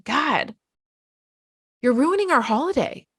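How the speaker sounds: put-on high, exasperated scolding at a panicking child; mock angry parent scolding a panicking kid; scolding parent rant. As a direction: berate them like a fed-up parent on holiday. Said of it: "me getting mad at you and saying".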